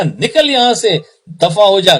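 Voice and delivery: high, exasperated "get out" outburst; acted angry dismissal. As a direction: send a nuisance packing, in a storyteller's imitation. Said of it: "وہ غصے میں کہنے لگے".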